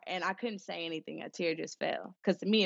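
normal talking voice

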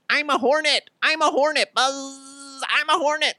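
high, loud buzzing hornet cry; goofy high hornet shout; soldier playing hornet. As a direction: shriek it like a hyped soldier pretending to be a hornet. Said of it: "he's running around going".

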